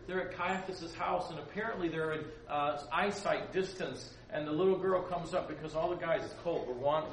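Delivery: ordinary talk, nothing marked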